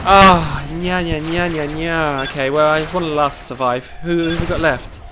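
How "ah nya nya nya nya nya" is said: put-on childish taunt. Teasing the enemy while losing.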